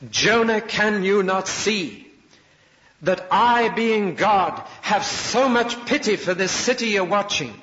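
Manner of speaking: God pleading with a stubborn prophet; impassioned plea. Acted. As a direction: beg him to understand.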